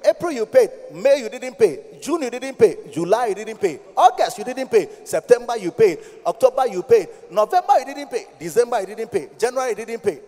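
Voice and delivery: loud, driving sermon cadence, hammering each month; preaching at full force. Acted. Pastor scolding the church over tithe, shouting sermon. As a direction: pound the congregation with every missed month.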